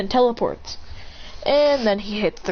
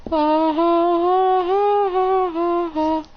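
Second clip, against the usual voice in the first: repeated yelps; 9 semitones higher, much slower than usual. Acted. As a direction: panic in bursts. Character panicking in the air.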